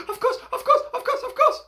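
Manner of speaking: very high, loud squeaky mice; tiny mice agreeing in a rush; eager mice. Acted.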